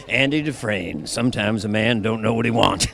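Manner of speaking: low, measured film-narrator impression; Morgan Freeman. Actor trying Morgan Freeman for interviewer, low-pitched impression. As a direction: drop it low, like a wise narrator. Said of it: "trying to do Morgan Freeman".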